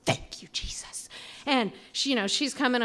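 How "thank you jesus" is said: whispered thanks of relief; relieved whisper. Retold or imitated. Sees a neighbor who can help, relieved whisper. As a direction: breathe it out as a grateful whisper. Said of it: "And I'm like".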